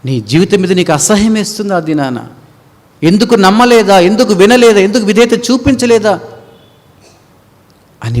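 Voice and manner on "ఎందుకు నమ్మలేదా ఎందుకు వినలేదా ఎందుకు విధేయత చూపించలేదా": higher, louder reproach; full-force preaching. Acted. Preacher voicing the sinner's regret, loud preaching. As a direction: hit each question.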